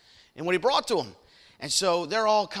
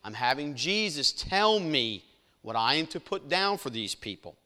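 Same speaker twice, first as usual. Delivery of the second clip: normal talking voice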